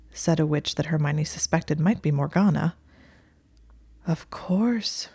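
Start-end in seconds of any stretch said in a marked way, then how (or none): 4.1-5.1 airy, breathless awe. A narrator's reading. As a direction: breathe it out in wonder. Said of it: "her voice breathless".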